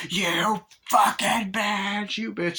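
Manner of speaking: high, slow snarling curse; furious Tiffany. Retold or imitated. Tiffany crawls out of the oven cursing Jade, slow and furious. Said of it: "Tiffany's like".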